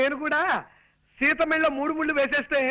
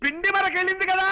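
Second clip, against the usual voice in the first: raised, projected delivery in short bursts; 4 semitones higher than usual. Acted. Man tells old aunt about a match, projecting. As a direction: proclaim it for someone hard of hearing. Shouting news to hard-of-hearing aunt.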